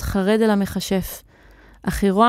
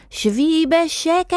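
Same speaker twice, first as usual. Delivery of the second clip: high, drawn-out yell; yelled order; 7 semitones higher, slower than usual. A narrator's reading. Wizard ordering the hen to sit, long yell. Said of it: "צעק המכשף".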